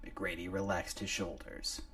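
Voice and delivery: ordinary delivery, nothing marked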